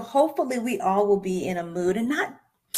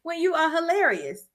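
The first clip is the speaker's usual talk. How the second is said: excited; 9 semitones higher than usual. Retold or imitated. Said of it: "I'm like".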